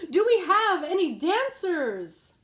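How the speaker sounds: the TV host calling out to the crowd; host shouting. A narrator's reading. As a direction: shout it to the whole studio. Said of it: "The host shouted".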